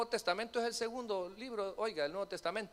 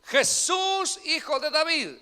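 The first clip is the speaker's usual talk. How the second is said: higher, loud plea; crying out; 5 semitones higher, 7 dB louder, slower than usual. Acted. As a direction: call out to Jesus from the roadside. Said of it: "Y a decir".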